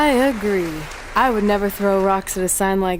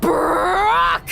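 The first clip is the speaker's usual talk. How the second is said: drawn-out, much higher yell of the name; stretched, high shouted name; dramatic shout; 13 semitones higher, much slower than usual. Acted. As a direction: belt out Brock Lesnar like a ring announcer.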